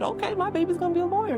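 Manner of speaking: high, quick motherly declaration; proud mother. Retold or imitated. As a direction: mom announcing her child's future. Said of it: "She said".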